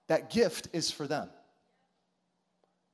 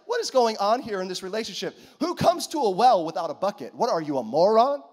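raised, scornful mock rant; mock indignant woman; 4 semitones higher, 8 dB louder than usual. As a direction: scoff at him like a rude stranger. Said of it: "she's like".

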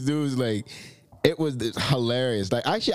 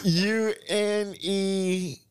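slow spelling out; 5 semitones higher, much slower than usual. Real, not acted.

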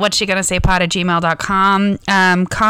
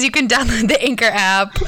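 ordinary talk, nothing marked

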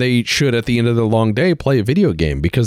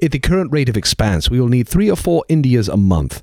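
mock British accent. Official explaining the balance of power in the empire, pompous mock British accent. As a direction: hold forth like a hammed-up British colonial grandee. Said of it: "with the most British accent you can".

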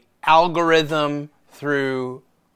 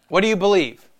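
high, loud pointed question; raised-voice challenge; challenging question; 6 semitones higher, 5 dB louder than usual. Acted. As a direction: demand an answer. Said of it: "When people ask me that question".

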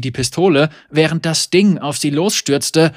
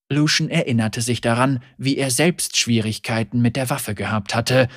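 usual speaking voice

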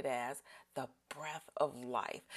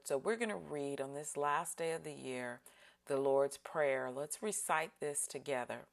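normal talking voice

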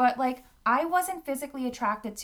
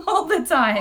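louder, higher, with a laugh; laughing emphasis; 7 semitones higher, 7 dB louder than usual. Real, not acted. Friend admitting constant sex talk, laughing emphasis. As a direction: repeat it gleefully through a laugh.

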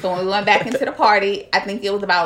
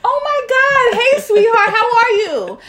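much higher and louder, sugary sweet greeting; sudden sweet voice; 7 dB louder than usual. Retold or imitated. Mom greets boyfriend's nephew in a high, sugary sweet voice. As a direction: switch instantly to sickly-sweet politeness. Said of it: "I just said".

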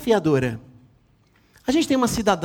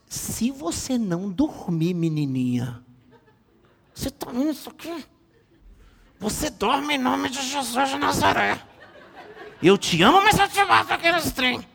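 mock threat; 6 semitones higher than usual. Father threatening daughter with slipper.

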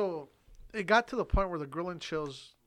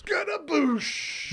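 goofy exclamation; 10 semitones higher, 5 dB louder, much slower than usual. Pretend. Silly exclamation.

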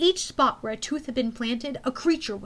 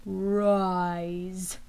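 low commanding; 5 semitones lower than usual. Acted. General's summoning.

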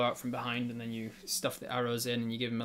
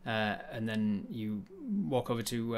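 ordinary delivery

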